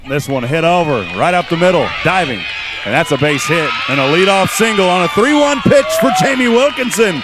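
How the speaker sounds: live softball hit call, climbing in pitch; excited play-by-play. Real, not acted. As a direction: build from a lively call to a high, loud peak.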